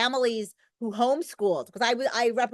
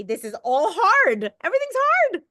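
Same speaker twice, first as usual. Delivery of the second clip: mock wail; 9 semitones higher, 6 dB louder than usual. Real, not acted. Exaggerated lament, then laughs.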